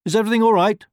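very high, loud pig voice; worried pig. A narrator's reading. Pig checking on the hen at her door, worried. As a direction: call out the question anxiously. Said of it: "he was very worried about her".

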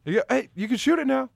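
loud, high coach shout; coach yelling. Retold or imitated. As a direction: bark it like courtside instructions. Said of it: "if you don't constantly yell".

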